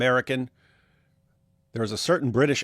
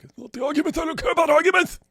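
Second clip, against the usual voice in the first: squeaky, very high mock voice; fast high-pitched parody of someone dismissing arguments; mocking imitation; faster than usual. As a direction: send them up in a silly falsetto. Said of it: "That's how you are. Like".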